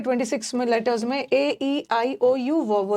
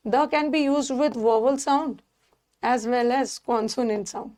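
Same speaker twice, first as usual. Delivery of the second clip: slow dictation; much slower than usual. Shown as a demonstration. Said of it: "teacher slowly stating the rule".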